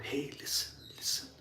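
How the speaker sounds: breathy inner-voice whisper. Pretend. God's voice calling from the heart, in a whisper. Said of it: "it whispers".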